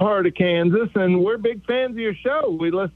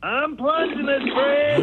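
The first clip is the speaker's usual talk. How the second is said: higher put-on wife voice calling across the house; mock wife yelling; 5 semitones higher than usual. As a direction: yell back at Fred from the bathroom.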